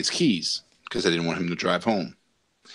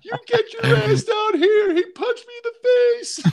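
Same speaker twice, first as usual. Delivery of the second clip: put-on girlish tattling; 4 dB louder than usual. Calling dad after the punch, hammed-up girlish whine. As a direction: squeal it like a kid running to dad. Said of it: "the n-, most girlish".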